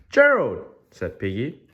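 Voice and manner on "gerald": high, loud call to a friend. Acted. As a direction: call Gerald's name, pitched way up and falling. Piggy calls out to Gerald.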